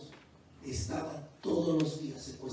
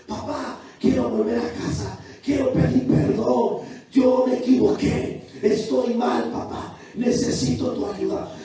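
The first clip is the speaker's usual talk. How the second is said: put-on high, loud tearful plea of a girl wanting to come home; voicing the daughter; 7 semitones higher, 13 dB louder, slower than usual. Preacher voicing his daughter calling home in tears, loud and high. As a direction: cry out the daughter's plea, pitched up and slow.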